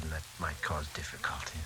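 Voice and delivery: breathy whisper trailing off. Acted. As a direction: whisper it, afraid of being heard. Hushing so as not to be overheard.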